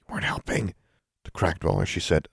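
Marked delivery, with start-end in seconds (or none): 0.0-0.8 soft voiceless scolding; whispering. Acted. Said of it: "she whispered".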